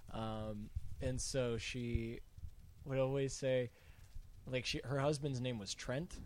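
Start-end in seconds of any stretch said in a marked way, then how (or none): none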